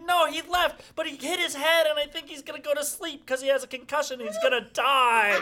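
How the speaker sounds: high, loud mock-panicked outburst; put-on hysterics. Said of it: "I'm sobbing and screaming".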